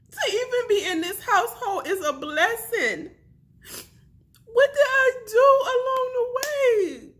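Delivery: loud, high crying through the words; desperate weeping, laughing through sobs; real sobbing. Real, not acted. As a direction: break down and plead through tears. Breaking down over infertility.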